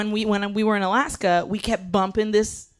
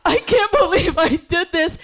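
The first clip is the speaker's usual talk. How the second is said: retold sobbing; 8 semitones higher, 4 dB louder than usual. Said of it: "sobbing openly".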